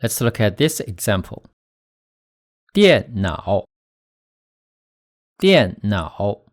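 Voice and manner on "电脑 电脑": 电脑 with stressed falling 电, then low dipping 脑; fourth plus third tone. Shown as a demonstration. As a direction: stress 电 downward, let 脑 sink low before rising. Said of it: "start low and go lower before rising".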